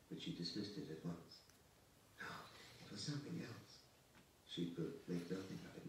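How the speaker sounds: usual speaking voice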